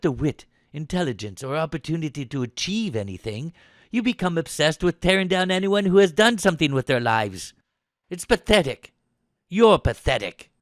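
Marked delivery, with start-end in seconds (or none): 3.9-10.5 raised voice, building to a loud final insult; contemptuous tirade. Acted. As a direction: tear into him with contempt. Officer's attack builds to insult.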